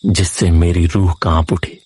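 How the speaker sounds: ordinary delivery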